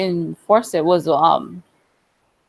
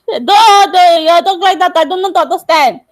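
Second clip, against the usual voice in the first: loud playful insisting; 8 semitones higher, 6 dB louder than usual. Real, not acted. Playful insistence, louder and higher.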